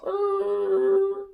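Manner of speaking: high, drawn-out skidding screech; screeching halt noise. Retold or imitated. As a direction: imitate paws braking hard on the road. Imitates dog skidding to a halt.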